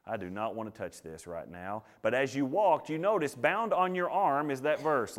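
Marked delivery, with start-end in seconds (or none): 0.0-1.8 reluctant groan. Retold or imitated. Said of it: "And you're thinking".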